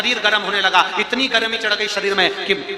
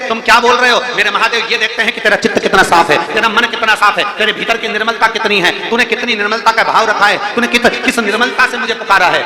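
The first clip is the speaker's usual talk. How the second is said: loud, higher fervent sermon voice; full-force preaching; 5 semitones higher, 6 dB louder than usual. Acted. Preacher exhorting the congregation on true devotion, fervently. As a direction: preach it out to the whole crowd.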